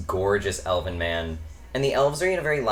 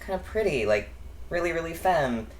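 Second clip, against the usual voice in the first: self-conscious musing; 9 semitones higher than usual. Retold or imitated. Looking at oneself in the mirror, shyly musing up high. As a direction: think aloud in a light, high murmur.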